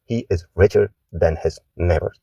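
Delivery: slow, careful model reading; teacher reads the answer slowly; slow model sentence. Shown as a demonstration. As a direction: say it slowly for learners to copy.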